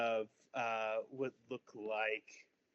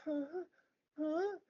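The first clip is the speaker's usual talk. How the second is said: high puzzled little huh; tiny kids not understanding; baffled children; confused kids; 4 dB quieter than usual. Acted.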